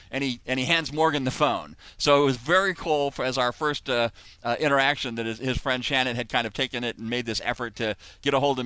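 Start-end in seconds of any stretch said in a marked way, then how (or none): none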